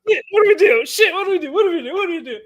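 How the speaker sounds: high, frantic, loud wail; acting out panic, voice far up high; retold panic. Rider sliding down the landing with broken ankle, panicked.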